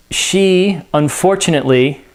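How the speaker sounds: ordinary talk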